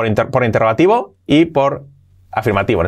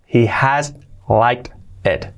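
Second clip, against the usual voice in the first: He will like it drawn out slowly; for learners to copy; slow model; much slower than usual. Shown as a demonstration. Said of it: "slow model".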